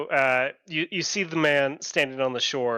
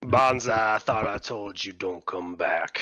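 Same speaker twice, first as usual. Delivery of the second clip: stern exiling figure; 5 semitones lower than usual. Acted. Man on the shore confronts exiled Banzai, stern and low.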